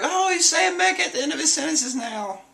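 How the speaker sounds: very high, giddy cartoon voice; amazed crewmates in squeaky voices; amused friends noticing. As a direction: point it out with giddy glee. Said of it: "they're like".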